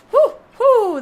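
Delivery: loud high cheering whoo; excited whoop. Real, not acted. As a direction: let out a thrilled whoop. Cheering after a big jump.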